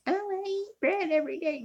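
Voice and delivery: high, breezy quoted celebrity; mock a carefree TV host; Oprah impression. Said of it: "She's a thyroid patient".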